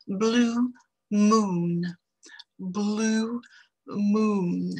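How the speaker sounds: blue moon with a long rounded oo, lips pushed forward; English oo in blue moon. Shown as a demonstration. Teacher showing French learners blue moon with a long oo. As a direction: say moon as a long tight oo, like a small kiss. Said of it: "Vous voyez mes lèvres".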